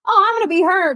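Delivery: high, loud starry-eyed exclamation; mock confidence. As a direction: burst out as if claiming a dream role. Retold imagined claim to dream role.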